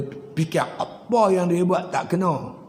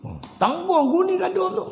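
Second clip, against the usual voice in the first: insistent Umar; 13 semitones higher than usual. Acted. Umar insists on carrying the wheat.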